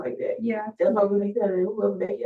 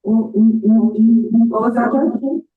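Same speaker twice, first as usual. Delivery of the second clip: loud and slow; 2 semitones higher, 9 dB louder, much slower than usual. Real, not acted. Asking over bad line.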